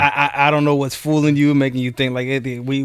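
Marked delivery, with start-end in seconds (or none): none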